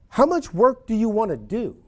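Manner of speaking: a sharp, impatient question to the agent; blunt challenge. Shown as a demonstration. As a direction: push back at the client bluntly. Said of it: "I don't say that, what I just said".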